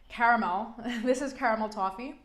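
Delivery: normal talking voice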